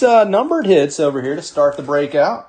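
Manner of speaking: normal talking voice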